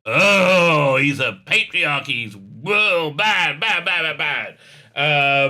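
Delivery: hammed-up sarcastic horror; mock outrage. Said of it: "wanting to show how evil this guy is".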